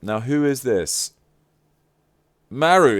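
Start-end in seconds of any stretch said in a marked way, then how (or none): 2.5-3.0 high, loud delighted cry of Maru; excited cry. Real, not acted. Gift opener delighted at seeing the cat Maru. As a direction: squeal the name with glee.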